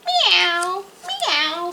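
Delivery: high, lilting meow; cat meow. A narrator's reading. Cat inviting the squirrel, mewing meow. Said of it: "said the cat".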